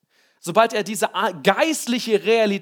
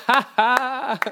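short real laugh, higher; amused laugh; 6 semitones higher than usual. Real, not acted. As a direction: laugh at yourself. Laughs at his own fear. (kurzes Lachen)

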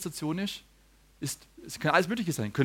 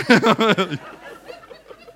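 loud laugh; 5 semitones higher, 8 dB louder than usual. Real, not acted. Laughs at boy getting comfy.

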